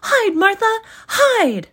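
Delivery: put-on very high, loud kids' urgent call; high, loud children's hide call. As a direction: hurry her out of sight. Children urge her to hide.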